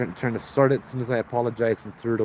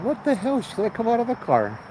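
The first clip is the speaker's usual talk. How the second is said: inner what the hell; 11 semitones higher than usual. Retold or imitated. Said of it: "I'm thinking to myself".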